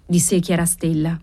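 ordinary delivery, nothing marked

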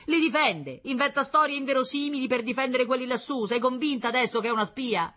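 high, loud, agitated argument; father insisting he is vindicated; exclaiming accusation. A narrator's reading. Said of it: "esclamò il sor Meletti". (accusa esclamata e concitata)

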